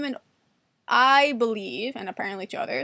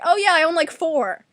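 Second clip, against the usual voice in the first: higher, louder enthusiastic reply; acted eager brag; 5 semitones higher, 7 dB louder than usual. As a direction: jump in with playful pride. Acts out eagerly bragging about knives.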